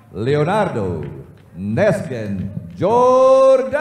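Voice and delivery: rising, long held final syllable; drawn-out name callout. Acted. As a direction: belt out the name, hold the end. Announcer calls out fighter's name.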